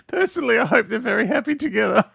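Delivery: high, squeaky with giggles; laughing. Real, not acted. Host jokes about the Yeti throuple, laughing. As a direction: push the wish out through laughter.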